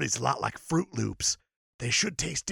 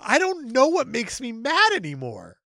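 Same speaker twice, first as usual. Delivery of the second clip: higher-pitched, hammed-up helpless cry; mock distress; 6 semitones higher than usual. Upset hero trying to get mad, mock distress. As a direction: play a touch of comic desperation.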